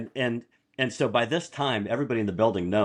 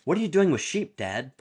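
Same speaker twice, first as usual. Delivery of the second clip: lightly voiced daughter, pitched high; mock daughter calling her dad, high and puzzled; a hint of a surprised daughter; imitating the daughter; 6 semitones higher than usual. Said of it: "called him up and said".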